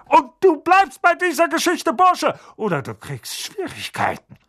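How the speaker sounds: loud, high angry uncle threat; hissed threat. A narrator's reading. Uncle threatening Harry, furious. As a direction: spit the threat at the boy. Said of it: "fauchte Onkel Vernon".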